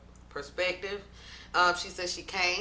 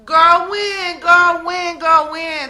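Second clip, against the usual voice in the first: incredulous repeated call; 9 semitones higher, 12 dB louder, much slower than usual. Real, not acted.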